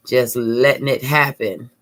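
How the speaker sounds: usual speaking voice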